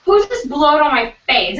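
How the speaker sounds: high, loud outraged question; indignant accusation. Retold or imitated. Friend accusing her roommates, mock indignant.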